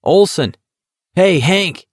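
high, teasing hail; calling out. A narrator's reading. McVries hails Olson teasingly.

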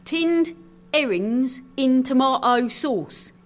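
high, slow, drawn out; announcing the dish. A narrator's reading. Sailor revealing the meal to visitor, slow announcement. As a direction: savour each word deliberately.

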